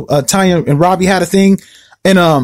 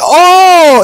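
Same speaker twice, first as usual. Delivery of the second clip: sudden realization; 10 semitones higher, 4 dB louder than usual. Retold or imitated.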